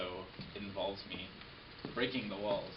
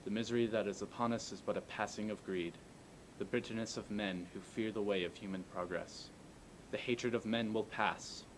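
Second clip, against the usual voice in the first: formal speech. Acted. Recites the speech.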